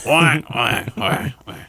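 squawking like the Penguin. Pretend. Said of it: "Was that a fucking penguin squawk".

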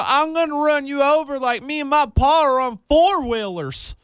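high country twang; hammed-up Southern accent; mock hillbilly. Mocking country characters.

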